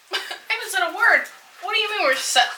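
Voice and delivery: high, fast mock protest in another voice; indignant heroine. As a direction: squeak out her outrage at being ignored.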